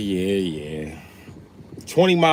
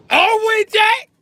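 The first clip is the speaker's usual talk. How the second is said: shrieked high and loud; squealing high-pitched yell; 9 dB louder, slower than usual. Acted. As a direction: shriek the words out. Repeats line then yells it.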